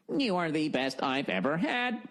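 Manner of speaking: lightly done stiff robot lover voice; a hint of an imperfect machine voice; bad robot voice. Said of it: "it still fucking talked like that".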